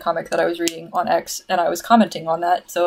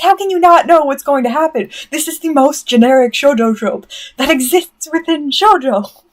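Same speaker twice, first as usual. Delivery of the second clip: loud, high-pitched disbelief; exasperated rant; 6 semitones higher, 5 dB louder than usual. Real, not acted. Fan to viewers who missed the obvious trope, exasperated rant. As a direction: rant at them, loud and incredulous. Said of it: "there's my rant".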